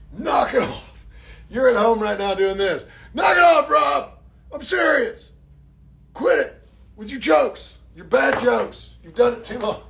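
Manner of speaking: pretend-angry fan telling him off; mock fan scolding. Pretend viewer yelling at Rob to quit his jokes. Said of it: "now you're like this".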